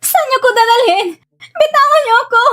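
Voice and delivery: high, frantic protest; panicked shouting. Acted. Captive demands her kidnappers let her go, panicked shouting. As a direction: cry out as you struggle to get free. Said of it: "Huwag ka nang sumigaw".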